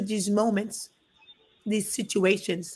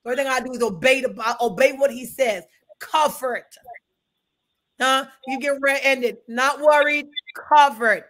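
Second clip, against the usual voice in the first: higher, louder call-and-response preaching; preaching at full force; 5 semitones higher, 5 dB louder than usual. Acted. Pastor preaching to the church about being covered, fervent and raised. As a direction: drive it home to the congregation.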